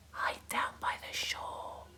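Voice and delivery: hushed secret whisper; conspiratorial whisper. A narrator's reading. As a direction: whisper to hide from granny. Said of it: "whispered Hector".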